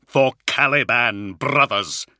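loud, drawn-out call to arms; rally the troops over the din; rallying battle cry. A narrator's reading. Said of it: "his voice echoing like a trumpet call".

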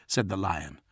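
ordinary delivery

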